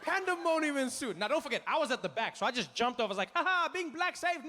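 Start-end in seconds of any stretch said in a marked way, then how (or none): 3.3-4.5 high, crowing getaway cry; mock gloating. Man at the back escaping first, comic gloating. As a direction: crow it in a raised voice.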